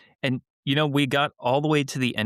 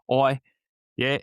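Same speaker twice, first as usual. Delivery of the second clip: higher, louder Aussie voice; Australian accent; 6 semitones higher, 3 dB louder, faster than usual. Pretend. Keeping promise to fan, hammed Australian accent. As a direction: give it your best Aussie. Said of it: "my best Australian accent".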